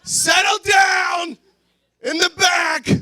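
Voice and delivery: wasted guy shouting in line; drunk yelling. Pretend. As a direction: yell over a rowdy buffet crowd. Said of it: "You're like".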